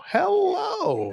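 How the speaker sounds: very high, loud, drawn-out hello; hammed-up cheer. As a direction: overdo the cheery greeting, way up high. Overdoing the greeting after serious remark.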